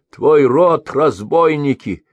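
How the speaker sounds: high, loud, slow jeer; insolent retort. A narrator's reading. Merchant answers the mercenary insolently. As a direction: throw the insult back boldly. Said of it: "дерзко ответил слепой".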